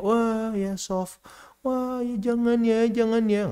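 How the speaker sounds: high, slow, overly gentle scolding; mocking soft parenting. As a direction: coo at the child like a soft parent. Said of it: "cara didik soft spoken". (mengejek gaya lembut, tinggi dan pelan)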